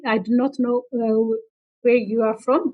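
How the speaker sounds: normal talking voice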